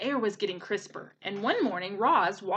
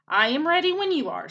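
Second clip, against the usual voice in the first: robot voice; 7 semitones higher, 6 dB louder than usual. Acted. Robot speaks to gosling.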